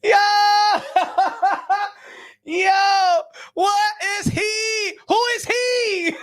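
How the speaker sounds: high, slow shouted words through laughter; laughing shout. Real, not acted. Streamer shouting at a picture through laughter. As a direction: yell it while cracking up.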